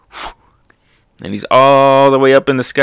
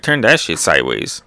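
normal talking voice

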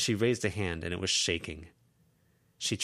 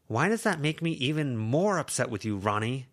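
mildly exasperated, a touch higher; 6 semitones higher, 4 dB louder than usual. Acted. Lucy to Verona, mildly exasperated. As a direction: let the frustration leak through.

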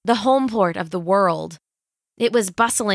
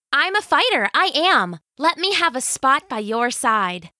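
put-on higher, fast and eager girl; eager teenage girl; 6 semitones higher than usual. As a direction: gush like a thrilled teen. Eager teen heroine.

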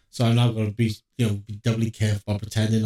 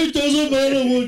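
mocking high quote; 14 semitones higher than usual. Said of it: "repeats friend's quote".

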